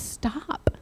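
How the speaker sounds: breathy unvoiced whisper; whispered stop. Retelling the twin hushing her sister in a whisper. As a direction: hush it out to the sister. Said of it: "she turns to her sister and she says".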